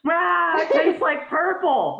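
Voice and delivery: put-on high, drawn-out baby voice; tripping baby. Comic voices a baby mid-trip, high and bewildered. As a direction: imitate a dazed baby on an acid trip. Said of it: "looks like they're mid-trip".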